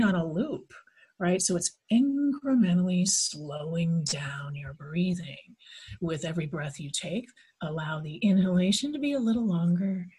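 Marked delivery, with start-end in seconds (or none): 1.4-5.4 hypnosis voice. Shown as a demonstration. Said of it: "Don't be adding that hypnosis voice on there". 6.0-10.1 hypnosis voice. Shown as a demonstration. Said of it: "Don't be adding that hypnosis voice on there".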